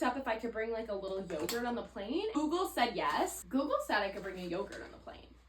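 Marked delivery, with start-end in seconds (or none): none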